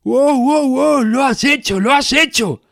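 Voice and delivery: high, loud, thrilled cheering; excited shouting. Acted. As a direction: cheer like a hyped-up life coach. Coach celebrates a breakthrough.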